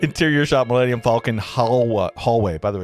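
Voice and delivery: usual speaking voice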